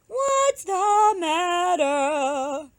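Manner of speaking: high, very slow magpie asking. Acted. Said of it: "asked the magpie".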